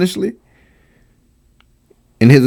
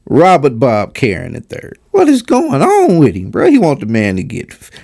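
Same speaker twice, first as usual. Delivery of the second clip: ordinary talk, nothing marked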